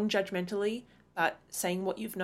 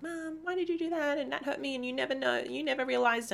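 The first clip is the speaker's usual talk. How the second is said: put-on much higher whiny kid voice; complaining grown kid; 7 semitones higher than usual. Future kids accusing mom, higher whiny voice. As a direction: whine like a hurt grown child. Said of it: "they're gonna be like".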